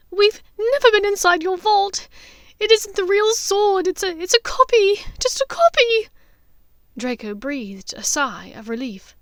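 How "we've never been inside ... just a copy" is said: high, loud desperate pleading; tearful pleading. Acted. Hermione pleading with Bellatrix, tearful and high. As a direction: insist through tears.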